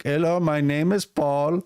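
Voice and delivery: slow, high mock Indian introduction; drawn-out Indian accent, imitating a phone caller; imitated Indian accent. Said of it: "'cause their accents were".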